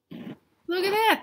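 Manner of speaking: high, slow, amazed "look at that". Acted. Reader gasping to children at the vanishing dog. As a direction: show the kids something astonishing.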